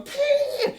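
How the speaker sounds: very high-pitched sound effect; imitated high squeal. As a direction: squeal it as a fight noise. Imitates noise in fight.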